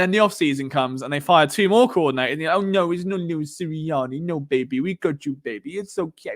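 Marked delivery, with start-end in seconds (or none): 2.5-6.3 mock babying. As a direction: coo to a pampered baby, mockingly. Voices bosses babying the coach.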